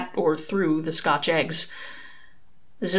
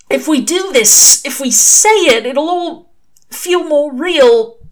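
halting fear; 9 semitones higher, 9 dB louder, slower than usual. Acted. Crowley halting, afraid.

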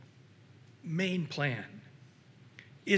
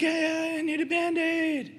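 put-on much higher, pleading little kid voice; whiny child; 9 semitones higher, 4 dB louder than usual. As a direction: beg for a Band-Aid like a small child. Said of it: "they're like".

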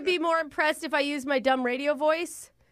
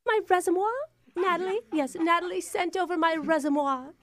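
mock-grand British, Frenchified "reservoir"; put-on British accent; 3 semitones higher than usual. Fake applicant asking about her resume, put-on British accent. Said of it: "surprised by your accent".

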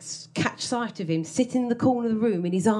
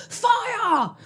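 high barked order; a touch of a commanding officer. Retold or imitated. Old man giving orders from his armchair, barked. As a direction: yell the order like a soldier. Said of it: "mumbling orders".

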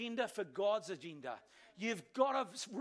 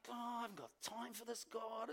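inner grumble; 9 dB quieter than usual. Retold or imitated. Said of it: "On the inside".

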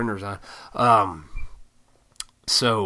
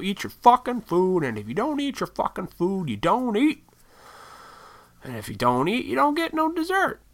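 strict parent laying down the rule, pitched higher; lightly voiced stern parent; old school parents; 10 semitones higher than usual. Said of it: "my parents were old school".

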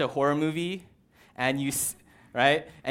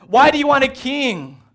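much higher and louder, a climactic cry; peak frustrated shout; 11 semitones higher, 12 dB louder than usual. Retold or imitated. Preacher shouting at the Israelites, top of voice. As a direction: let the question burst out at full force. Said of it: "there's this part of me that wants to shout".

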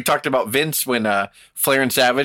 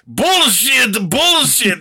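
much higher and louder, repeated furious yell; angry shout; 9 semitones higher, 4 dB louder, much slower than usual. Retold or imitated. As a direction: yell it at the wrestlers like a fed-up promoter. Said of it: "made Inoki go".

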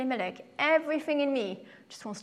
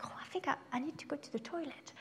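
sheepish whisper; 4 semitones higher, 9 dB quieter, faster than usual. Retold or imitated. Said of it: "And I was like".

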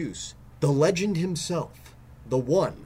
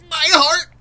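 loud high death cry; dying gasp; 13 semitones higher, 10 dB louder than usual. Acted. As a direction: clutch your heart and cry out. Host dies on air.

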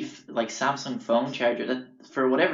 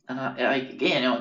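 ordinary talk

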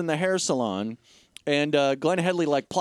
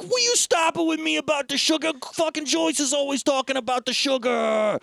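mock angry rant; 7 semitones higher than usual. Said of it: "And he's like".

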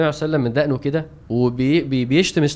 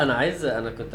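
ordinary talk, nothing marked

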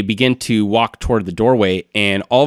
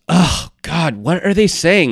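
exasperated groan rising higher; fed up, pitched up, demanding to know; higher, exasperated question; 8 semitones higher than usual. Acted. Acted exasperated character, bullywugs ranting.

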